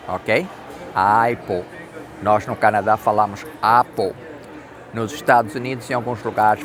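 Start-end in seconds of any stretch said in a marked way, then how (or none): none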